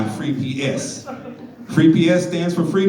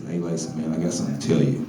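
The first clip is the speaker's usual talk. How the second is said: lightly voiced caller, low and hushed; 13 semitones lower, 7 dB quieter, much faster than usual. Caller phoning Lason with a confession, low and hushed. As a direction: voice dropped much lower and softer for the caller.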